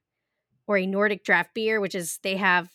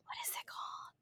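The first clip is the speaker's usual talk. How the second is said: whispered to herself; whispering; 20 dB quieter than usual. Real, not acted. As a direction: whisper it, trying to remember. Forgetting a name mid-show.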